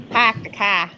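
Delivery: overdone, not very good Boston accent; poor Boston accent. Shown as a demonstration. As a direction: have a clumsy second go at Boston vowels. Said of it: "No, that's not very good".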